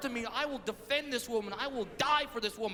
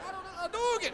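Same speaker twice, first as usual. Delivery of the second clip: retold panicked yell; 8 semitones higher than usual. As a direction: blurt a game move in a high voice. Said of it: "I just yelled it out".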